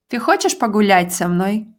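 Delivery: "Ты хочешь погулять со мной?" said quickly, rising on хочешь; fast natural question. Shown as a demonstration. Teacher repeating the question at native speed. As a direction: rattle it off naturally. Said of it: "do you want to go for a walk with me".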